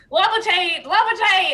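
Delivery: high, loud, commanding casting; dramatic spell shout; spell incantation. Acted. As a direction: call the spell out like a wizard. Player casting a spell aloud.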